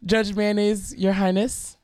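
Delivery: imitating a theatrical young lawyer; dramatic student lawyer. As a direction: address the judge with mock grandeur. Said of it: "being as dramatic".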